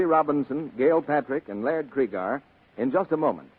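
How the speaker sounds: usual speaking voice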